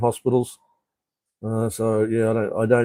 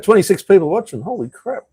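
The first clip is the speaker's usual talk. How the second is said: higher, genuinely taken aback; a sudden lift of real surprise; surprised; 8 semitones higher than usual. Real, not acted. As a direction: react with real surprise at the number. Surprised by the viewer count.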